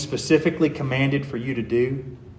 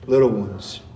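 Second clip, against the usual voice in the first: tender endearment; 8 semitones lower than usual. Acted. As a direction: coax them gently like small children. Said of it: "a term of endearment and compassion".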